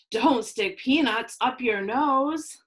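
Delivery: ordinary delivery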